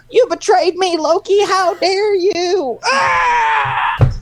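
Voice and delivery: very high, slow outraged accusing voice ending in a yell; Owen Wilson impression. Shown as a demonstration. Friend requests the Owen Wilson bit, high outraged wail. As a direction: wail the accusation high and stretched, then cry out. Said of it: "Do that Owen Wilson impression again".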